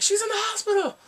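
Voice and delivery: high, loud mock outcry; upset mother. Yumi's mom at the house, high upset outcry. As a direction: wail it accusingly in a high voice.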